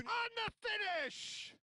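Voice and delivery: high, slow shout; excited shout. Real, not acted. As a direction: call the finish at full voice. Finish attempt called.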